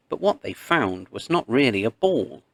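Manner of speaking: ordinary delivery, nothing marked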